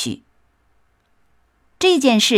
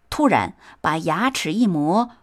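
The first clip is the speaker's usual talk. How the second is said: plain reading voice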